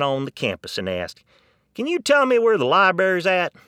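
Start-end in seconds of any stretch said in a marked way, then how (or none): 1.7-3.5 loud, high Texan question; Texan asking a stranger for directions; Texan accent, loud and friendly; Texan visitor. Retold or imitated. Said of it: "the Texan who went up there".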